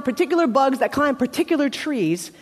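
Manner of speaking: ordinary talk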